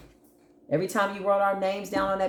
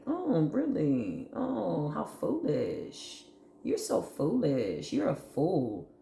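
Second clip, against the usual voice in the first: slow, soft and drawn-out; sarcastic; 2 semitones lower, 5 dB quieter, slower than usual. Real, not acted. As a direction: drag it out with quiet contempt. Sarcastic reply after mocking quote.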